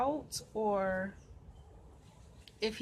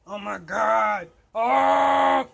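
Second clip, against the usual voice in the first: slow, loud cry rising to a scream; panicked cry; 11 dB louder, much slower than usual. Real, not acted. Woman pushing the bird, crying out in panic. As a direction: cry out and break into a shriek.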